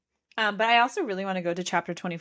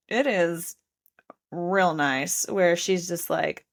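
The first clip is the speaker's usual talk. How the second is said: ordinary delivery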